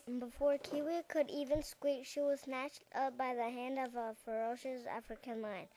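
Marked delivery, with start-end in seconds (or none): none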